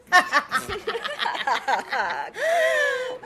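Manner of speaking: high shrieking laugh; loud laugh. Real, not acted. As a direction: burst into a loud cackling laugh. Laughing after fake accent bit.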